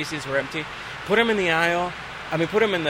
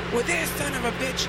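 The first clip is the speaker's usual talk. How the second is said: higher, gruff captain voice; 8 semitones higher, 4 dB quieter than usual. Retold or imitated. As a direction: grumble like an irritated captain. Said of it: "He's like".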